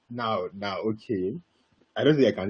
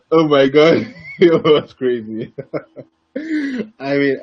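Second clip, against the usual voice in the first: real laughter breaking into the words; higher voice, cracking up at your own story; laughing; 8 semitones higher, 6 dB louder, much slower than usual. Real, not acted. Cracking up at own retold shout.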